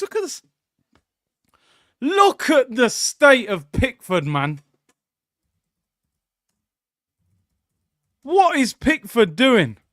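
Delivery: slow, loud, drawn-out ridicule; milk the keeper's blunder; mocking disbelief. Real, not acted. Streamer ridiculing Pickford's failed save, slow and loud.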